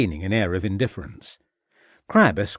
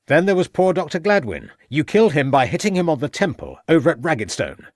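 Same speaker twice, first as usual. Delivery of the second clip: much higher and louder accusation; raised voice; 5 semitones higher, 4 dB louder than usual. A narrator's reading. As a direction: hammer the accusation home. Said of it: "raising his voice again".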